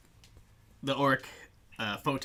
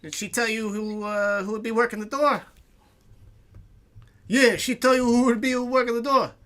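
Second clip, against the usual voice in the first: loud, high, mocking bouncer; suspicious orc bouncer; 6 semitones higher, 6 dB louder than usual. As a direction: sneer and press them, loud.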